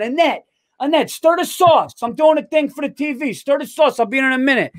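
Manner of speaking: lightly voiced Italian guy yelling to his wife; Italian president calls his wife Annette about the sauce; Italian husband calling out. President calls to wife mid-speech.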